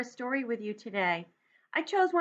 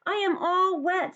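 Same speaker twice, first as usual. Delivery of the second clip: higher, sulky little Toad; Toad's voice; 9 semitones higher, 5 dB louder than usual. Acted. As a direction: lift the voice for a glum Toad. Toad complains about rain.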